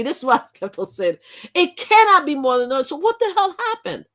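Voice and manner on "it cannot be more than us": higher and louder, "cannot" stressed; insistent denial. Retold or imitated. Vlogger repeating what she kept telling herself, emphatic. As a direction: pound the word "cannot". Quoting her own insistence.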